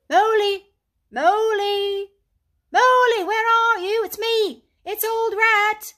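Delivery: high, loud cheery shouts, slowing on the last words; calling out cheerfully. A narrator's reading. Rat searches the wild wood, shouting cheerfully for Mole. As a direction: hail a lost friend through the trees. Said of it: "calling out cheerfully".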